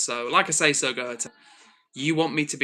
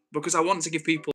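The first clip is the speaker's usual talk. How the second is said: normal talking voice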